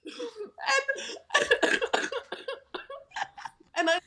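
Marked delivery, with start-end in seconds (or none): 0.0-2.0 high laughter. Real, not acted. Laughs at son's blunt answer.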